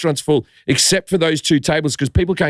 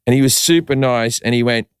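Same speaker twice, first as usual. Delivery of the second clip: ordinary talk, nothing marked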